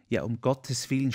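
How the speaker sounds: plain reading voice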